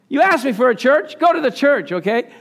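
angry Lord. Retold or imitated. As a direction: scold him like an angry God. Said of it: "And the Lord got angry".

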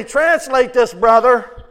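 mock loud call-out. Imagined church call-out.

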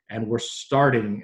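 starting leaned on, said slow and deliberate; slow down and press the word starting; stress on starting. Real, not acted. Analyst stressing a word to the host, slow deliberate stress. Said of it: "emphasis the word starting".

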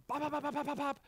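high, rapid-fire bop sounds; machine-gun popping syllables; rapid pecking noises. Shown as a demonstration. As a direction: imitate chomping in quick staccato bursts. Imitates biting at someone.

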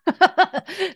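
short high-pitched chuckle; real laugh. Real, not acted. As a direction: break into a quick, real laugh mid-sentence. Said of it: "I'm laughing because I got".

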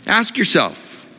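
usual speaking voice

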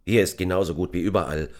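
usual speaking voice

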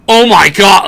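loud, high burst of shock; the room gasps at the tweet; shocked exclaim. Retold or imitated. The room reacting to Adam Schefter's tweet, loud shock. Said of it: "Adam Schefter tweeted".

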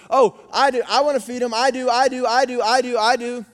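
put-on high, loud, insistent little girl; imitating eager child. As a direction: pipe up like a kid desperate to be picked. Imitating eager four-year-old daughter.

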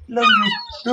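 very high squeaky love you; tongue flutter zoom. Pretend. High squeaky farewell take.

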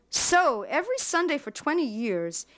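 rising high and quick toward the end; a burst of agitated disbelief; fast, high, pressing question; excitedly. A narrator's reading. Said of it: "said Bluma excitedly".

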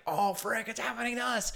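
high, held-back wail; squeezed-down acted cry of alarm; mock panic. Acted panic as car lifts.

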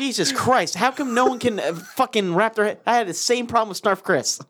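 high, worked-up incredulity; exasperated rant. Real, not acted. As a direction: complain in exasperated disbelief. Ranting that nobody gets the book.

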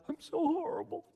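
mock self-pity. Preacher mocking false humility, high whiny self-pity. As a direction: ham up a person wallowing in their own badness. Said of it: "It's not".

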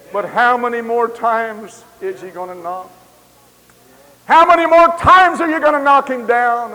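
building to a loud, high cry; preaching at full force. Real, not acted. Preacher challenging the crowd, building to a shout.

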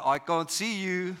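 mock a dad cooing to a toddler; playful dad voice. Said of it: "like a dad playing with a toddler".

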